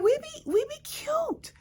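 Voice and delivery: high, soft sing-song "we be cute"; playful sassy voice. Pretend. To her viewers, a cutesy high sassy boast. Playful self-praise aside.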